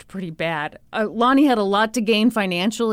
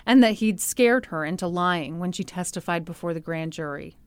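normal talking voice

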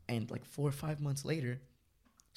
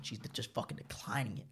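ordinary talk

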